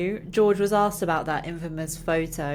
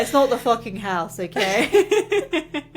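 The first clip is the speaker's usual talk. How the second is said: mock defensive George; 5 semitones higher, 4 dB louder, slower than usual. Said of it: "George is like".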